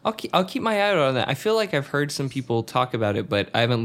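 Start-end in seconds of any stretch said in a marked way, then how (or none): none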